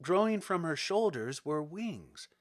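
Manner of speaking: plain reading voice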